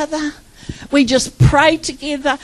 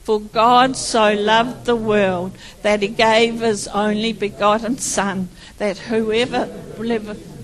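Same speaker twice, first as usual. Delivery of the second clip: recited scripture; 4 semitones lower than usual. Shown as a demonstration. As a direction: recite the verse together, unhurried. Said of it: "Let's all say it together".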